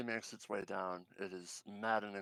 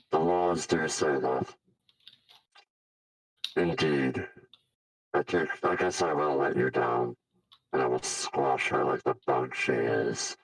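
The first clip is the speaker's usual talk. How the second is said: low, loud menacing villain; hissing reptile growl; 5 semitones lower, 9 dB louder than usual. Acted. Villain Raz accepts duel.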